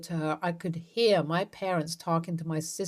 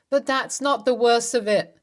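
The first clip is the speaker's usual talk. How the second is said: louder and rising with anger; 5 semitones higher, 5 dB louder than usual. A narrator's reading. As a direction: push it up, voice climbing. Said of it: "my voice rising".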